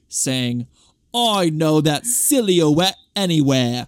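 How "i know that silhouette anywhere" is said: slow, hammy mock Cockney accent; a lightly done fake London accent.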